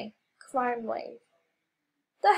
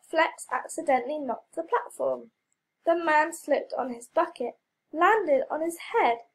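plain reading voice

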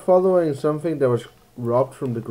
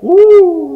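high whoop; 16 dB louder than usual. Pretend. Whoops after angel bit.

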